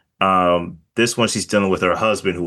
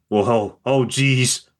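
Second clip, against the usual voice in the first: Midwestern fluster; 2 semitones higher, slower than usual. Retold or imitated. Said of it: "comedic moments where she's like".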